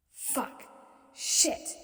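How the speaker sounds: breathy whispered swearing; whispered curse. A narrator's reading. Panicked inner cursing.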